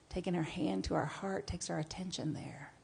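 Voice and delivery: soft whisper. Acted. Guided heart practice, whispered.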